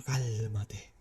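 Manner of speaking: put-on breathy hush to settle a teen; whispered calm down. Preacher hushing an agitated teenager in a whisper. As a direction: soothe him with a whisper.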